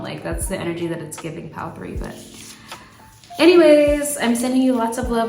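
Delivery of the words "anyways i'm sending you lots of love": excited reset. Real, not acted. Loud bright sign-off turn.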